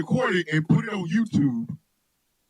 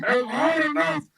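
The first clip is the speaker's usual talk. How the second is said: mock panic; 8 semitones higher, slower than usual. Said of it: "You're going".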